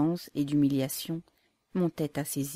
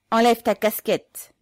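loud, slow, high command; officer yelling at the prisoner; shouted order; 6 semitones higher, 10 dB louder than usual. A narrator's reading. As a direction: hammer out the order. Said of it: "cria l'officier".

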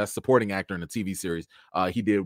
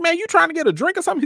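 eager offer; 4 dB louder, much faster than usual. Retold or imitated. Offering Cillian a drink.

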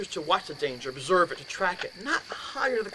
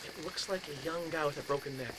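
soft, eerie description; hushed dread; 2 semitones lower, 10 dB quieter than usual. Retold or imitated. Roommate describing the figure he sees, hushed and eerie. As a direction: say it quietly, as a chilling reveal.

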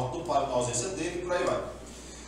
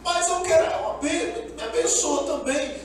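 much higher pleading; pleading Esaú; 10 semitones higher, 3 dB louder than usual. Retold or imitated. Esaú begging his father for a blessing, high pleading. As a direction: beg high and urgent. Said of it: "diz que ele fala assim".

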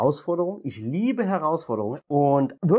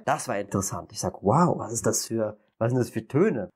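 ordinary delivery

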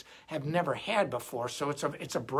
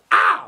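mock yelp; 14 dB louder than usual. Hit in the night.

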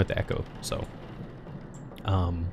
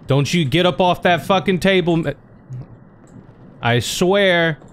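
raised, threatening warning; angry threat; 6 semitones higher than usual. Real, not acted. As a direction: warn it off, fed up. Threatening corpse on the table.

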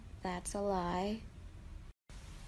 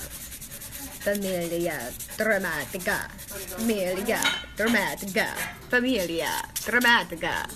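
mock Italian; 5 dB louder than usual. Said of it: "I have no idea what I just wrote".

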